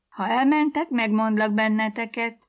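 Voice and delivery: high, loud threat to tell; tattling threat. A narrator's reading. Child threatens to tell on siblings.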